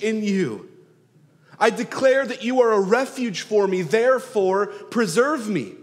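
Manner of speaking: fervent plea. Acted. Voicing David's plea with force.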